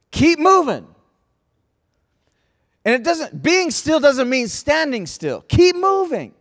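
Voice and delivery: high, loud "Keep moving" cries; preaching at full force. Acted. Preacher urging the church to keep moving, loud. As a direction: push them onward with each call.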